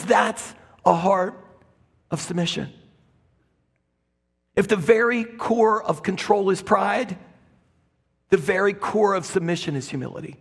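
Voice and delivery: ordinary talk, nothing marked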